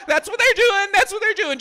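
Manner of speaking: high, loud, insistent shout; comic indignant yelling; exasperated rant. Acted. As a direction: hammer it home as a worked-up warning. Ranting about dating app users.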